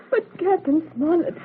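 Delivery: crying, drawn-out protest; tearful plea. Acted. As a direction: choke up as you beg. Daughter upset at the window.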